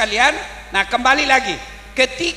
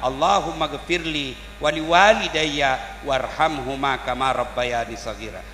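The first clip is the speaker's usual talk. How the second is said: prayer recitation; 7 semitones lower than usual. Retold or imitated. Child praying for parents.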